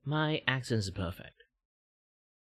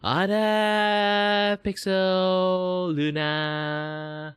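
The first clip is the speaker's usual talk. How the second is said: high greeting voice; 11 semitones higher, 7 dB louder, much slower than usual. Shown as a demonstration.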